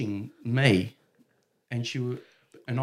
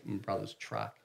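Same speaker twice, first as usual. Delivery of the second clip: usual speaking voice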